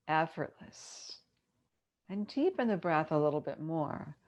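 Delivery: unhurried yoga nidra guidance with long pauses; measured, spacious relaxation-teacher delivery; guided meditation. Acted. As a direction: ease them into rest, phrase by phrase.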